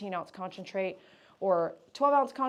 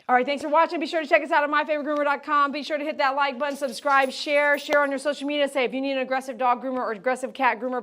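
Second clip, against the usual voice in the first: fast, louder upbeat outro read; promo sign-off; 5 semitones higher, 8 dB louder than usual. Acted. Groomer signing off to viewers in an upbeat promo read. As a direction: rattle off the plugs with energy.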